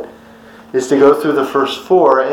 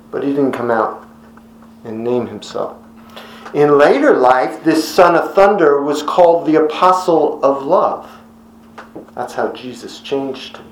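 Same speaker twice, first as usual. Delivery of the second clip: ordinary delivery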